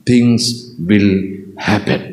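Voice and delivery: a pause after every word; Things, will, happen, each set apart; deliberate pauses for weight. Acted. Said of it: "word by word pauses".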